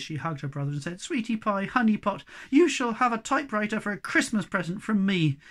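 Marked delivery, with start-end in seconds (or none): 1.0-5.4 high, loud, delighted gushing; overjoyed, raised-pitch endearments; beaming. Acted. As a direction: gush affectionately with a beaming smile. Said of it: "Beaming all over her face".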